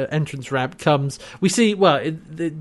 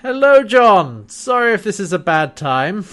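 Scorpius; 5 semitones higher, 4 dB louder than usual. Retold or imitated. Villain turns up uninvited to greet John, theatrically polite. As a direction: hail your foe loudly with mock courtesy, stretching the hello. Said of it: "And Scorpius, of course, goes".

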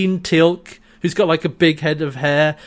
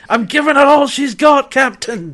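Scottish engineer, higher and louder; Scottish accent; 7 semitones higher, 4 dB louder than usual. Retold or imitated. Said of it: "he was very good at doing a Scottish voice".

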